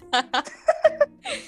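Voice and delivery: squealing laugh; loud high laugh. Real, not acted. Keeps laughing.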